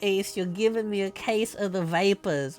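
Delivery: slow, drawling Southern belle voice. Acted. Streamer hamming up a Southern belle for chat. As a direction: swoon it out like a fainting Southern belle. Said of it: "Vera's a voice actress".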